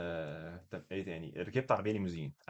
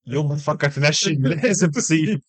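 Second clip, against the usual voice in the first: mock solemn proverb; 5 semitones higher, 10 dB louder, slower than usual. Punchline proverb, declaimed.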